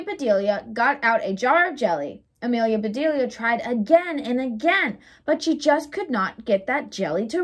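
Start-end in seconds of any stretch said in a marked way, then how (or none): none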